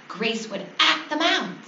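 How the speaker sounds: ordinary delivery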